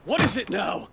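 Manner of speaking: exasperated. Acted. Exasperated at new interruption.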